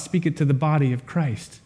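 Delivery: ordinary talk, nothing marked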